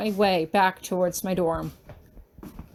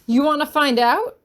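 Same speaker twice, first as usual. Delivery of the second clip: higher and louder, squaring up; defiant yell; 5 semitones higher, 5 dB louder than usual. Acted. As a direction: throw it at him like a threat. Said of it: "I yell".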